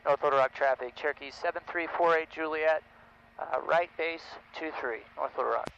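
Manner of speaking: loud, clipped pilot radio call. Real, not acted. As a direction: announce position over the radio.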